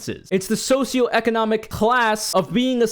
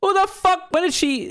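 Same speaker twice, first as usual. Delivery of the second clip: incredulous; 11 semitones higher than usual. Real, not acted. Outraged reaction to claim.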